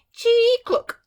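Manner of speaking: high, loud clunky chirp; clockwork bird. Acted. The golden toy bird singing badly for the Emperor, clunky chirp. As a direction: chirp like a broken wind-up toy.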